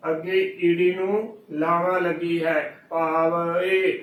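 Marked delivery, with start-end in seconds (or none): 2.9-4.0 ਭਾਵ ਏ slowly as "paa-va-e"; correct drawn-out reading. Shown as a demonstration. Teacher spelling out the word, ਭਾਵ ਏ as slow "paa-va-e". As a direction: give ਭਾਵ ਏ a separate, stretched final e. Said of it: "ਇਸ ਸ਼ਬਦ ਦਾ ਜੋ ਉਚਾਰਨ ਹੈ".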